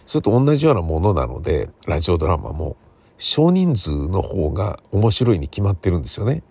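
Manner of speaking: usual speaking voice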